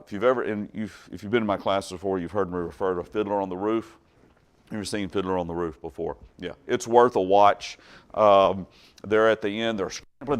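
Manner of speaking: normal talking voice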